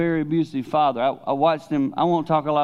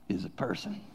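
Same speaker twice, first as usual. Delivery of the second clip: whisper; 3 semitones lower, 13 dB quieter than usual. Acted. Revealing Jordan's inner humanity.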